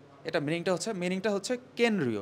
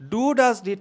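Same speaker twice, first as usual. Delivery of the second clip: loud, drawn-out mock shout of the rule; re-enacted classroom shout; 5 semitones higher, 11 dB louder, much slower than usual. Teacher recalling a rule he yelled in class, mild mock shout. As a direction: hammer the rule out slowly and loudly. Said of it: "ক্লাসের মধ্যে চিল্লায় চিল্লায় বলছিলাম".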